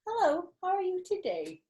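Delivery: high, sugary innocent greeting; sweet talk to dodge trouble; overly polite innocence; very pleasant. Acted. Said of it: "I smiled, very pleasant".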